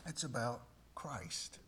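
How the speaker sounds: a soft voiceless whisper to close the thought; whispered punchline. Acted. Preacher whispers the answer to his listeners, hushed. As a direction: let the line fall to a breath.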